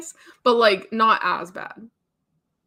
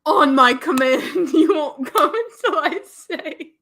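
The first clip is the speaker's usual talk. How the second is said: louder, higher hammed-up commanding lover; mock a bossy romance hero, breaking into laughter; laughing parody of a dominant boyfriend; 5 semitones higher, 5 dB louder than usual. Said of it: "the dominant, I was like".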